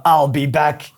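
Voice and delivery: put-on a light Austrian-accented quote of the famous movie line; a hint of a thick Austrian accent, half joking; Arnold Schwarzenegger impression. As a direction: toss off a quick Austrian movie-star catchphrase. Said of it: "going back to Arnold".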